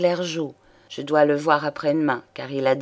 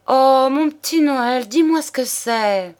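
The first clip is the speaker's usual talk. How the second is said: high, bright coaxing plea; coaxing young woman; 9 semitones higher, 5 dB louder than usual. Acted. Juliette coaxes her lover Noël to tell his secret, in a high pleading voice. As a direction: wheedle him sweetly, voice pitched up.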